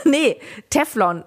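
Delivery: amused at your own slip; laughing self-correction. Real, not acted. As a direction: correct yourself through a laugh.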